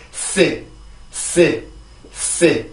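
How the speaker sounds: 쓰 as a sharp English S, hissed through a narrow gap; syllable drill. Shown as a demonstration. Teacher modelling the start of secure, syllable drill. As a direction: say 쓰 short, mostly hissed S. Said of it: "자, 해볼게요".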